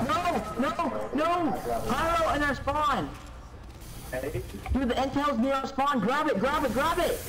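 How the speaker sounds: high panicked yelling; panicked shouting. Real, not acted. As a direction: yell it in a rising panic. Pyro in spawn panic.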